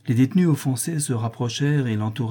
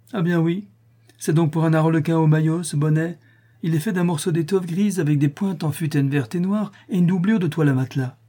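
higher, jeering prisoner voice; higher mocking jeer; 6 semitones higher than usual. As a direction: sneer it out like a rough inmate.

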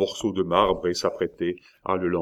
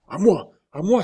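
high, loud cry for rescue; cry for help; 12 semitones higher, 6 dB louder than usual. A narrator's reading. Young man crying to onlookers for help, urgent cry. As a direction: call out urgently, pitched well up. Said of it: "s'écria le jeune homme".

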